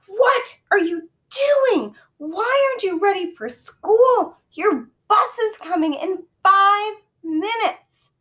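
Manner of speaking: high, loud, slow scolding; yelling mother. A narrator's reading. Mother yelling at her son late for school, high slow scold. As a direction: yell at the late child. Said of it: "My mother was yelling at me".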